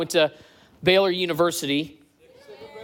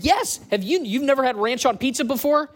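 amazed roommate; 7 semitones higher than usual. Retold or imitated. Roommate amazed he never tried ranch.

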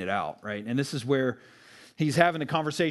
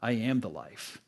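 soft whispered confidence, much softer; leaning in, whispering; 6 semitones lower, 8 dB quieter than usual. Acted. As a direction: lean in close and whisper it to her. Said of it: "Jesus leans in".

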